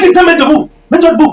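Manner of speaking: high, loud insistence; furious order. Acted. Man insisting his partner get up, furiously loud. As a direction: shout the command forcefully.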